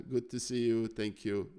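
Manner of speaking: ordinary talk, nothing marked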